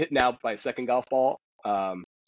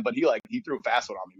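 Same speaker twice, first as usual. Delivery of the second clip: ordinary delivery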